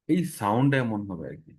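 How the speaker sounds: usual speaking voice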